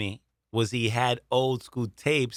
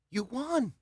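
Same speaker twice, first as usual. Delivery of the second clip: imitating the owner; 13 semitones higher, 6 dB quieter than usual. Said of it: "He goes".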